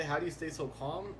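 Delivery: ordinary talk, nothing marked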